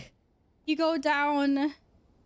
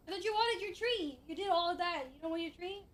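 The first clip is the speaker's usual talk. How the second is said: higher and much softer pet voice; 4 semitones higher, 8 dB quieter than usual. Real, not acted. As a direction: coo gently at the cat. Talking softly to the cat.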